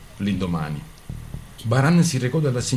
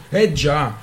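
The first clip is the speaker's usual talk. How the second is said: higher, louder indignant complaint; 6 semitones higher, 4 dB louder than usual. Acted. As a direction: list her expenses, exasperated. Couple quarrelling over money.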